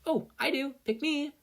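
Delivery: very high, bright and eager; eager volunteer. Acted. Ochako volunteering to the teacher, very high and eager. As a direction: beg to be picked.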